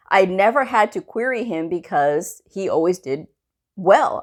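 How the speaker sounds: ordinary delivery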